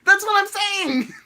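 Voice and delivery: high, loud, slow exasperation; exasperated. Real, not acted. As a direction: throw up your hands vocally. Heated back-and-forth about teasing.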